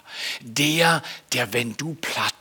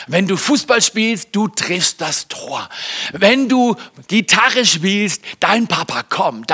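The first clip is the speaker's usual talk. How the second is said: encouraging; 6 semitones higher, 5 dB louder than usual. Retold or imitated. Father cheering his kids on.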